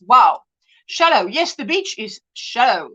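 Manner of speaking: normal talking voice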